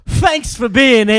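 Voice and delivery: high, shouted mock-Cockney; British accent. Comedian asked to say thanks, hammed-up British accent. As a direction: belt it in an exaggerated British accent, slowly. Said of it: "In a British accent".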